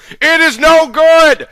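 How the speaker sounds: high, loud, slow shout. Acted. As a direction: shout it in despair over the noise. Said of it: "shouted Indian Jones".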